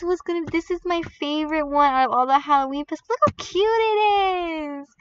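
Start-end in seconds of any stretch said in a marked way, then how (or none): 3.1-4.9 high, long drawn-out squeal; slow, high-pitched delight; adoring squeal. Real, not acted. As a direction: gush slowly over a cute pet. Gushing over favourite pet.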